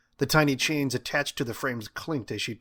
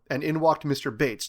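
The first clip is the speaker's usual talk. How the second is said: usual speaking voice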